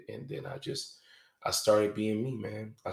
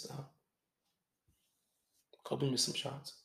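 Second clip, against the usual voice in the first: ordinary talk, nothing marked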